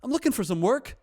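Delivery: put-on high, loud amateur Irish accent. Actor in character asks restaurant owner for work, in a put-on Irish accent. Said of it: "I put on the Irish accent".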